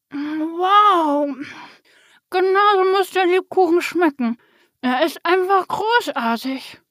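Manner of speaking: loud, high, chewing enthusiasm; mouth full. A narrator's reading. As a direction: rave through a mouthful of gingerbread. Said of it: "Mit vollem Mund". (begeistert mit vollem Mund, laut und hoch)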